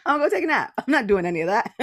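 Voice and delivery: giggling fake stubbornness; laughing mock refusal. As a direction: play the defiant napper for laughs. Repeats the joke, laughing.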